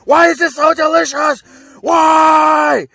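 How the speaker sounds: very high, very loud shrieking shout; crazed shout. Retold or imitated. Beerus losing it over the soup, shrieking shout. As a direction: scream the question out in food-crazed joy. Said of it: "he just goes crazy".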